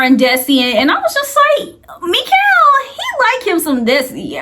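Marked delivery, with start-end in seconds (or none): 2.0-2.7 excited. Real, not acted. Excited call-out of a name.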